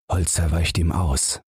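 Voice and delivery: plain reading voice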